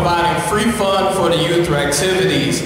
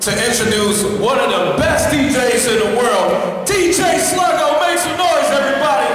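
hype announcer shout; 3 semitones higher than usual. Real, not acted. Introducing the DJ loudly.

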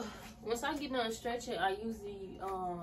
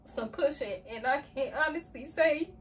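louder, tear-filled journal reading; emotional reading; 3 semitones higher, 6 dB louder than usual. Real, not acted. Reading a journal entry aloud, voice emotional and tearful. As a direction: read on though the tears keep coming. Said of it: "I did not expect to cry".

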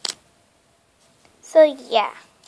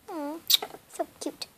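ordinary talk

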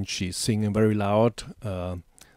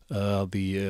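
usual speaking voice

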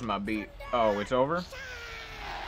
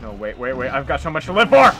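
fast plea climbing louder; mock desperate plea; 3 semitones lower, 6 dB louder, much faster than usual. Player begging the dinosaur for life, mock desperate plea. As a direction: rush it out, building to a yell.

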